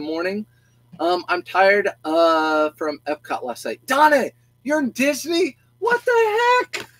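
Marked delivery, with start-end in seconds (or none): 3.9-6.9 high, slow, exaggerated disbelief; surprised. Real, not acted. To a viewer who turned out to be at Disney, high and amazed. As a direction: stretch it out in mock astonishment.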